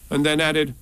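ordinary delivery

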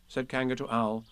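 plain reading voice

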